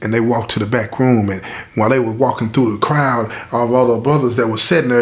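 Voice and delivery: ordinary talk, nothing marked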